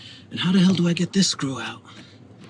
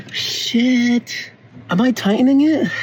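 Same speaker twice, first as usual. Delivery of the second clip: higher, louder frustrated yelp; frustrated outburst; 11 semitones higher, 3 dB louder, slower than usual. Real, not acted. A man fights a stuck screw, frustrated outburst. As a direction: blurt it out, fed up with the part.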